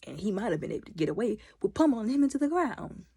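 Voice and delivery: ordinary delivery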